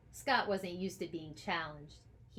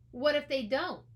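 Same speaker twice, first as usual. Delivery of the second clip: sullen challenge, louder; a boy scowling back at his teacher; 6 semitones higher, 6 dB louder than usual. Acted. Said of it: "said Scott with a scowl".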